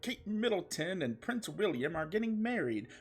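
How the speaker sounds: high, hammed-up indignant Jon; outrage. Jon telling Garfield he is not invited, mock outrage. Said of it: "said with outrage".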